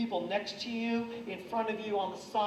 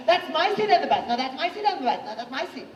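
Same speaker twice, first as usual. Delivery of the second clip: put-on bickering kids over a bus seat, higher; mock girls arguing; 5 semitones higher, 3 dB louder, faster than usual. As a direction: play two girls squabbling over a seat. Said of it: "two girls arguing".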